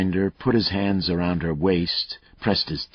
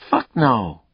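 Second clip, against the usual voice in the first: a lightly voiced cocky killer, higher and louder; taunting killer; 8 semitones higher, 7 dB louder than usual. Killer Mayle taunting Lucas over the phone, cocky and loud. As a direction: goad the cop, enjoying himself.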